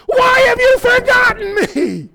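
high, loud anguished wail; crying out. Acted. Psalmist crying out to God in verse nine, full anguished shout. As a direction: scream it to heaven, long drawn out. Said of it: "He's crying out to God".

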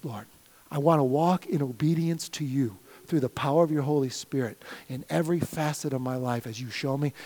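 soft, low earnest prayer; quiet prayer. Acted. Pastor praying aloud to the Lord, soft and low. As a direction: pray it softly to the Lord.

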